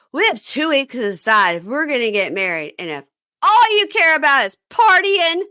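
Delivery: high, loud, exaggerated ultimatum, imitated; mocking fiancée voice. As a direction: mimic her shrill demand, rising loud. Retelling fiancée's ultimatum in a fight.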